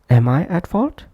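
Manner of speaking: "Am" said fully and strongly, question rising on "fault"; prominent full-form "am". Shown as a demonstration. Teacher demonstrating the full-length form, "Am" pronounced prominently. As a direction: give "Am" its whole vowel, then lift at "fault". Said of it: "that's why pronounced prominently".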